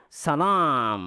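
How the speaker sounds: "Salam" sent through the nose; long a of "Salam" resonating in the nose; nasal voice. Shown as a demonstration. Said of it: "Suara hidung".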